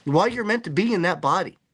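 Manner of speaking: normal talking voice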